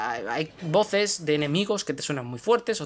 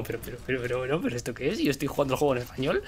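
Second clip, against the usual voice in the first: bewildered; 4 semitones lower, 3 dB quieter, faster than usual. Retold or imitated. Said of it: "Y es como".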